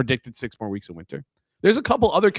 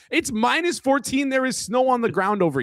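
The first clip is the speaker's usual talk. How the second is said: exasperated emphasis; 10 semitones higher than usual. Real, not acted. Stressing the Canadian cold.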